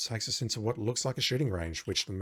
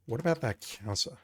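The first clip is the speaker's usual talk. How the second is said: usual speaking voice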